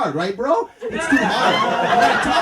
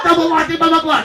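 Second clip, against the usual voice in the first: louder, higher mock coffee order; mocking customer; 4 semitones higher, 6 dB louder than usual. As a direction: rattle off a mock customer's babble. Mimics customer placing order.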